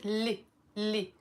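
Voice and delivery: "لي" said as a clipped li, no initial ya; short "li". Shown as a demonstration. Teacher showing the spoken form of the word, clipped. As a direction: drop the first syllable, just "لي". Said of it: "we will pronounce it".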